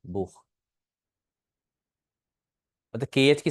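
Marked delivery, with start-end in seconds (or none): 0.1-0.4 बुख ending in a soft friction from the throat; throaty kh sound. Shown as a demonstration. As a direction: say बुख with a breath-like German ch at the end. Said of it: "हे की sound है".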